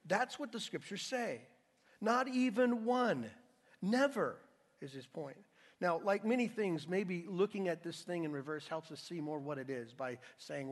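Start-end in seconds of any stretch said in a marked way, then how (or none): 2.0-4.4 slow, loud and high on Not even one; emphatic. Shown as a demonstration. Preacher stressing the verse to the church, loud and slow. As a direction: hammer each word. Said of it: "the most emphatic, strongest no".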